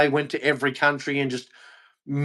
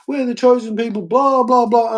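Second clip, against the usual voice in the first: lightly mocking smug tone, pitched higher; a touch of smug superiority; mocking haughty voice; 8 semitones higher than usual. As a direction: parody of someone on a high horse. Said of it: "start thinking".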